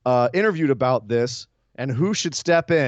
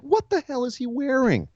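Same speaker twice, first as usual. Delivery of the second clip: incredulous; 12 semitones higher than usual. Retold or imitated. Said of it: "I was like".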